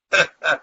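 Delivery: brief, loud chuckle; short laugh. Real, not acted. Host after joking about exile to Mars, short laugh. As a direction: laugh briefly at your own joke.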